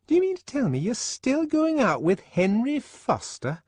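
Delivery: high, quick, chattering woman's voice; a fussy friend prattling on; gossipy Fanny. Acted. Fanny astonished.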